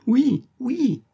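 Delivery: very high squeaked yes through a gag; piercing chirped oui oui; gagged yes. A narrator's reading. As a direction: strain a yes past the gag. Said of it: "les lèvres lançaient un ri, ri éclatant".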